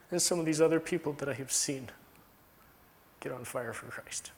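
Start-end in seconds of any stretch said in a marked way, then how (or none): 3.2-4.3 hushed whisper. Real, not acted. Preacher to congregation, hushed whisper. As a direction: drop to a whisper on the fire for Christ.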